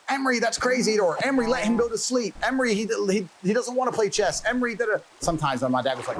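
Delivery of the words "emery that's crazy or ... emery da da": much higher pleading mother voice. Retold or imitated. Mother begging father to let the boys be, high pleading. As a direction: beg the father to ease off. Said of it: "my mother trying to be like".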